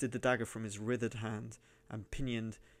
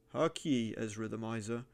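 plain reading voice

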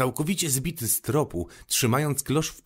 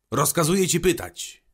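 quick, higher, louder order; hasty royal command; 7 semitones higher, 4 dB louder than usual. A narrator's reading. King quickly permitting the Little Prince's questions, hasty command. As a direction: rush to give the order. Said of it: "pospiesznie powiedział król".

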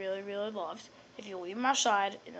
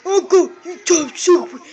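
loud, high garbled squawk; mocking character voice; 7 semitones higher, 12 dB louder than usual. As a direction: squawk it out as a hammed-up impression. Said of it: "that's funny how we talk".

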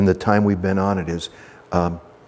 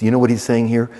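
usual speaking voice

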